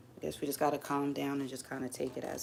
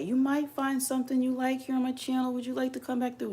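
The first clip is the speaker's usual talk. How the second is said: high, bright-eyed promo voice, much higher; mock eager promo pitch, pitched way up; upbeat channel pitch; 11 semitones higher than usual. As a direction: sell the channel like a cheery host. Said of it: "I used to say".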